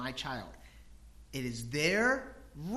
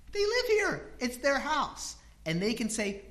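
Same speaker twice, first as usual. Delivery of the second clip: ordinary delivery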